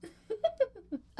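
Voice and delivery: high, loud burst of laughter. Real, not acted. Vlogger amused by metaphor clip, high laugh. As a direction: crack up briefly, high and loud.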